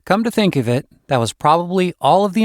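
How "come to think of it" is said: ordinary delivery, nothing marked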